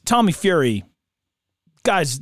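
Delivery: ordinary delivery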